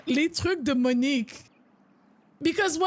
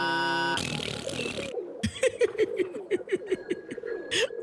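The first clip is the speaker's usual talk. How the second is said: burst of laughter; 3 semitones higher, 4 dB quieter than usual. Real, not acted. Laughing at odd game figure.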